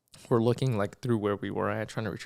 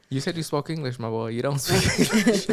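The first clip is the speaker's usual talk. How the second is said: laughing tease; 4 semitones higher, 6 dB louder than usual. Retold or imitated. Telling the clerk he can't speak English, laughing. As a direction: tease him while cracking up.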